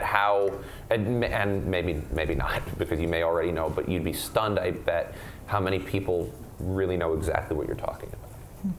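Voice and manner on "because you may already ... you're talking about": ordinary delivery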